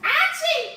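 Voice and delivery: sharp high yelp of pain. Real, not acted. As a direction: yelp as a finger burns. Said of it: "I just burnt my finger".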